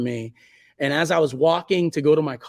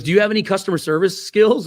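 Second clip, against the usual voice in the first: quizzing friend; 6 semitones higher, 7 dB louder than usual. Retold or imitated. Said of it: "he goes".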